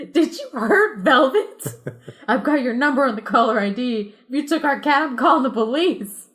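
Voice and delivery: high, loud accusing voice; cat owner threatening to call police; suspicious owner. Acted. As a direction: threaten the caller.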